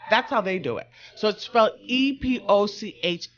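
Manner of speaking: normal talking voice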